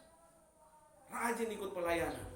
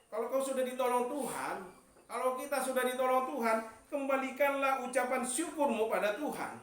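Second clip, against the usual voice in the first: rising, louder sermon voice; preaching; 8 semitones higher, 5 dB louder than usual. Acted. Preacher urging the church to give thanks, loud and rising. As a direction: preach it out to the congregation.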